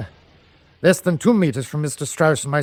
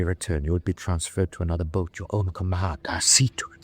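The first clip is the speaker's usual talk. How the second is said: hushed, urgent whisper; 7 semitones lower, 6 dB quieter than usual. Acted. Captain whispering a private promise to his officer. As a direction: whisper the promise so no one hears.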